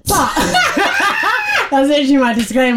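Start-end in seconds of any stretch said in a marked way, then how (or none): 0.4-1.7 high-pitched cackle; burst of laughter. Real, not acted. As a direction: crack up at your own joke, high. Laughing at own disclaimer.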